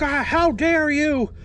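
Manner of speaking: high, slow mock-indignant gasp; outraged purist. Viewers scolding him over an engine swap, in mock outrage. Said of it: "You're like".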